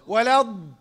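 "ولا الض" with heavy ض, lips left relaxed; unrounded dad. Shown as a demonstration. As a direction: press the ض of "ولا الض" without rounding the lips. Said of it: "وما ضمتش الشفتين".

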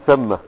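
"ثم" with a after th, thamma; fatha on tha. Shown as a demonstration. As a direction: say "ثم" as THAM-ma. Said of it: "بفتح الثاء". ("ثم" بفتح الثاء)

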